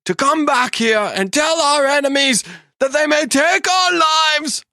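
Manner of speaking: put-on rally the troops in a Scottish accent, building from steady to roaring; Scottish accent Braveheart speech. Showing off her memorized Braveheart speech, Scottish accent swelling to a shout. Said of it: "All right, I'm gonna try one".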